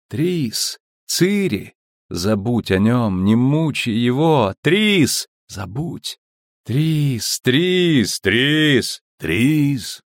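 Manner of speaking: high urgent calls; calling out. Acted. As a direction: call her name desperately. (отчаянный зов)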